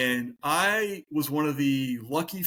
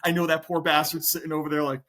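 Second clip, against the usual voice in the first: normal talking voice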